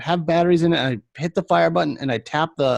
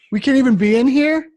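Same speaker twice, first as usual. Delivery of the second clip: put-on higher, louder indignant kid voice; teen kicked out of a vape shop, mock indignant; mock whiny teen; 10 semitones higher, 6 dB louder than usual. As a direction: play the outraged teenager. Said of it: "kids protest being told leave".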